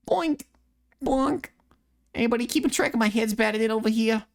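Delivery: put-on high, fast cocky Boston kid voice; brash bragging Scout; high taunting Scout mimic; Scout impression. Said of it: "Mimic Scout from T F two".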